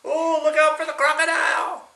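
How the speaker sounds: high, mock-alarmed cry. Grandpa's playful high warning about the crocodile.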